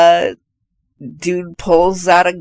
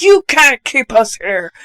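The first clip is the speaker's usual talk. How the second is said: mock indignant protest; 5 semitones higher than usual. Acts out trapped husband protesting.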